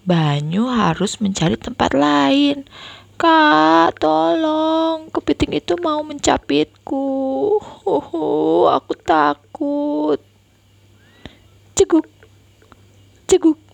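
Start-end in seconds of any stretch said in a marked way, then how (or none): 3.2-10.2 put-on high frightened child's plea; scared pleading. Boy begging sister to save him from crab, scared. 11.8-13.6 high loud hiccup sounds; scared hiccups. Acted. Frightened boy hiccupping at crab, high. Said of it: "makin sering dia cegukan".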